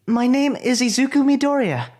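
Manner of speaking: high, loud introduction shouted inward; calling out. Acted. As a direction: project your name across a noisy room. Announcing himself into the building.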